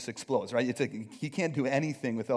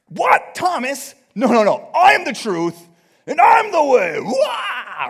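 high, loud, ranting mock God voice; angry God; 10 semitones higher, 13 dB louder, slower than usual. God snapping back at Thomas, hammed-up furious shout. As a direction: bellow it like an offended, angry deity. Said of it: "how you could hear God respond to that".

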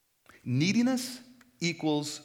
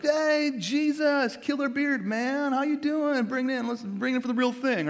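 high, loud bro-style hello; hammed-up excited greeting at the door; over-friendly greeting; 10 semitones higher, 4 dB louder than usual. Acts out greeting Jesus at door.

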